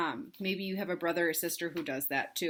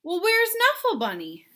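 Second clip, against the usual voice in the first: higher, louder surprised mom; mommy asking; 10 semitones higher, 5 dB louder than usual. Acted. Mommy at the door asking where the bunny is, raised pitch. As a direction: ask brightly, a lightly voiced mom. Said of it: "she said".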